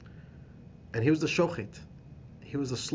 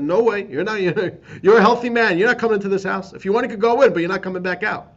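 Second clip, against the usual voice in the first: much higher and louder, barking refusal; guards' refusal; 9 semitones higher, 13 dB louder than usual. Retold or imitated. Guards turning the friend away at the quarantine home, loud refusal. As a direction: shout them off the door.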